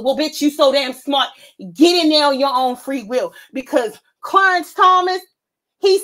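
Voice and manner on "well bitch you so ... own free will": ordinary delivery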